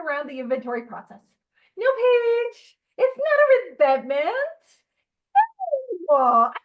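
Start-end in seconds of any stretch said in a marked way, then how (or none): 1.8-4.4 high, loud defensive protest; mock indignant denial. Acted denial to sponsor. 6.1-6.5 loud flat refusal; mock firm no. Acted denial to sponsor.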